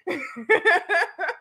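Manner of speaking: high laugh; real amused laugh; short teasing laugh. Real, not acted. Laughing at the secret name.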